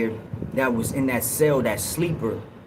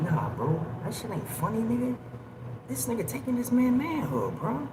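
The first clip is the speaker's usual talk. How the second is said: hushed scolding; 8 semitones higher, 7 dB quieter than usual. Retold or imitated. Scolding his celly quietly at the vent.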